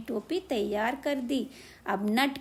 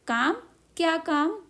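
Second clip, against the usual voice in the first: high, louder irritated "क्या काम?"; angry question; 5 semitones higher, 3 dB louder than usual. A narrator's reading. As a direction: snap back at the mouse, higher. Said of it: "दर्जी ने गुस्से से पूछा".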